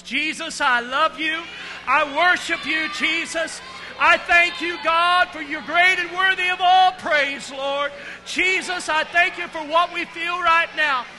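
loud, high, fervent praise; full-voiced worship shouting; playground voice praise. Real, not acted. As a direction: shout praise to Jesus, leading the crowd. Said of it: "joining us with this playground voice".

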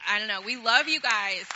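emotional shout. Real, not acted. Thanking staff and students.

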